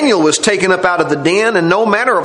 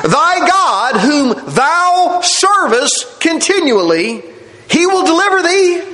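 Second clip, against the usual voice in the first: raised pitch, slow helpless plea; helpless, faintly hopeful; 7 semitones higher, slower than usual. Acted. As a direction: say it helplessly with the barest hope. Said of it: "saying it helplessly and ... the barest degree of hopefulness".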